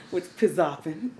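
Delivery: giggling through the slang; laughing. Real, not acted. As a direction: laugh it out playfully.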